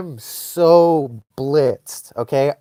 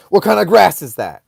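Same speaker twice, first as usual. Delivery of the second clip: loud, raised mock demand; punchy, higher indignant question; pissed-off mom; 4 semitones higher, 6 dB louder than usual. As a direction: imitate an angry mom interrogating. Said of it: "She was pissed".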